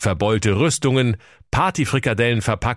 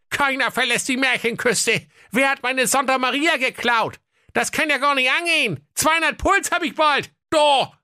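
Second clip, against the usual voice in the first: very high, flustered king; squeaky outraged ruler; upset king voice. Acted. (hohe aufgeregte Königsstimme)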